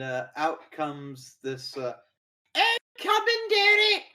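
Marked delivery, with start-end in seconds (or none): 2.5-4.0 put-on high, loud old lady voice; squeaky elderly woman calling out. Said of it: "this, like, very old, uh, woman".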